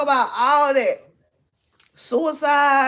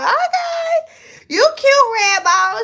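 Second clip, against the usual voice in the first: high sing-out of approval, with a laugh in it; squealed, teasing compliment; 12 semitones higher, 5 dB louder, slower than usual. Retold or imitated. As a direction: imitate a girl hyping up a cute guy.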